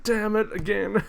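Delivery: high, slow mimicked frustration; mock exasperated curse. Streamer quoting a critic on the acting, exaggerated mock frustration. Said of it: "his acting was more like".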